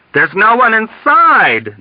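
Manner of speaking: loud, high, drawn-out cry; sudden yell. A narrator's reading. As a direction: yell it out in horror. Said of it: "Dunbar yelled out at him".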